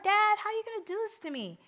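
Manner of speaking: put-on high, fast, whining teenage complaint; pleading with her dad, mock whiny and fast; whiny plea to dad. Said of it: "I was like".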